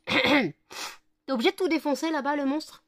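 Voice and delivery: high, loud teasing scold; mock scolding the dog. Real, not acted. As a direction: tease the little monster. Addressing dog playfully scolding.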